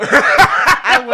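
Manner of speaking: real laughter through loud, crowing words; laughing loudly. Real, not acted. Mom telling host her story's punchline, laughing hard. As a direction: crow it out while cracking up.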